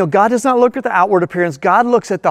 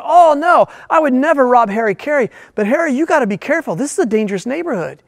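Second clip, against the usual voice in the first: gushing fan; 6 semitones higher than usual. Retold or imitated. Thug gushing at celebrity.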